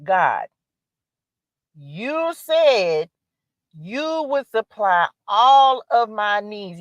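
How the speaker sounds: slow, drawn-out prayer to God. Shown as a demonstration. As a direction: plead with God slowly, word by word. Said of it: "this me praying".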